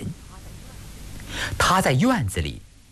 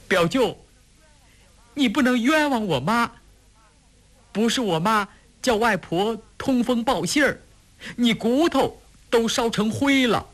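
high-pitched, indignant youth; speaking up loudly. A narrator's reading. Boy defends his mother to his uncle, loud protest. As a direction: protest hotly in a high boyish voice. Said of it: "大声地说".

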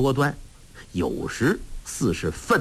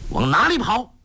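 higher, louder call; shouting after him; 9 semitones higher, 3 dB louder than usual. Acted. Young hero shouts after the fleeing villain, loud and higher. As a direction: yell it at a fleeing enemy. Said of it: "郭靖叫道".

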